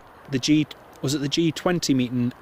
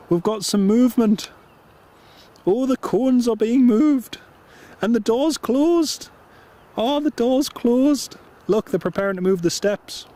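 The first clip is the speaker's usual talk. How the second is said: excited; 9 semitones higher than usual. Real, not acted. Aircraft starting to move on stream.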